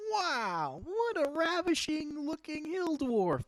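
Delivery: high, loud, slow gushing woman; flirty shopkeeper woman. Acted. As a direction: gush over the dwarf, high and slow.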